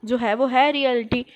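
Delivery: loud, dismissive comeback; defiant retort. Retold or imitated. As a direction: brush off the friend, loudly. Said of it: "I was like".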